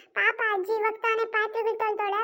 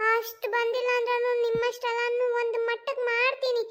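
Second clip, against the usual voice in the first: usual speaking voice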